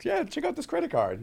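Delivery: very high, light imitation; bartender voice. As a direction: mimic the bartender.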